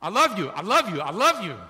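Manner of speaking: loud repeated I love you; loud husband. Retold or imitated. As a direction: talk over the worry with it. Husband repeating same answer.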